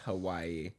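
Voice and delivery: Hawaii with a W, "huh-WAI-ee"; common mispronunciation of Hawaii. Shown as a demonstration. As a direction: say Hawaii the everyday way, with a W. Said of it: "and it's wrong".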